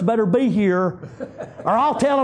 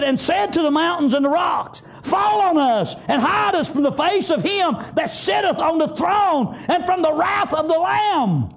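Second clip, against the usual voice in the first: high, shouted scripture, slowing at the end; full-force preaching; 5 semitones higher than usual. Acted. Preacher shouting Revelation to his flock, high and slowing. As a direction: cry the verse out over the congregation.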